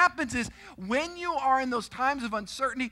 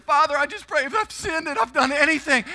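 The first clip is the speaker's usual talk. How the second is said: raised, loud, pleading confession; retold panicked prayer; 5 semitones higher, 6 dB louder than usual. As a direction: pray like the ride may kill you. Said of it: "I'm scared".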